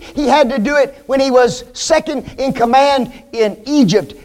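usual speaking voice

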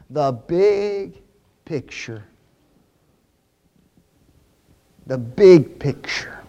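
slow, low "big picture" with BIG drawn out; stress on BIG. Real, not acted. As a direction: let "the big picture" sink in. Preacher driving home key phrase.

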